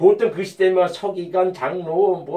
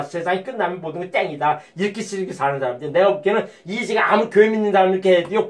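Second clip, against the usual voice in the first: ordinary talk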